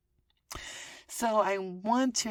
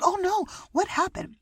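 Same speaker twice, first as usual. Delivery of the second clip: surprised; 12 semitones higher, 5 dB louder than usual. Retold or imitated. Concerned quote to neighbor.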